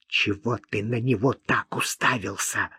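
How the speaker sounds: hushed, hissed reproach; angry hiss. A narrator's reading. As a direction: hiss it angrily under your breath. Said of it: "гневно прошипела она".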